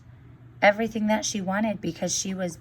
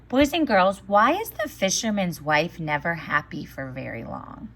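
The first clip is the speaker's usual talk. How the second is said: ordinary talk, nothing marked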